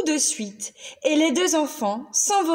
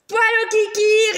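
put-on higher, louder teasing girl; cheeky little girl; 8 semitones higher, 5 dB louder than usual. Lucille teases the raging monster, high and cheeky. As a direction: sing it out cheeky. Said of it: "répondit Lucille".

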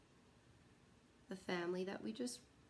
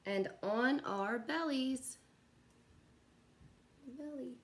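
slow, gentle storybook read with long pauses; reading to children; 2 semitones higher than usual. Acted. Teacher reading a bedtime picture book to kids, slow and gentle. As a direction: lull the little ones toward nap time.

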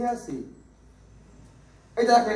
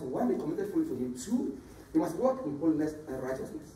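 ordinary talk, nothing marked

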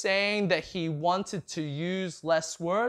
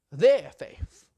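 "Their faith" punched louder, falling at the end; stress on their; 7 dB louder than usual. Acted. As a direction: hit "Their" hard and let "faith" fall. Repeating the key words for emphasis.

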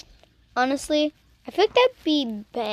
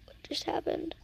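whisper; 15 dB quieter than usual. Real, not acted. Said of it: "whispered reaction".

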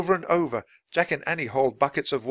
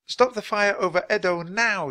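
high-pitched urgent command; girl's high, commanding cry; shouted; 8 semitones higher than usual. A narrator's reading. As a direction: shout it as a spell. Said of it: "she shouted".

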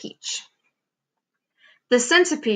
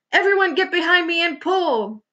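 high-pitched urgent command; rally everyone with a raised voice; shouted rallying call; 8 semitones higher than usual. A narrator's reading. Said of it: "shouted the old green grasshopper".

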